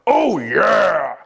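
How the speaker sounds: loud, high wrestler-style cry; big excited yell of agreement; enthusiastic shout. Shown as a demonstration. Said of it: "an agreement of enthusiasm and excitement".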